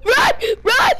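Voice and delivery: panicked yelling. Real, not acted. Kid fleeing a monster, panicked shout. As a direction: shout it in real panic, high.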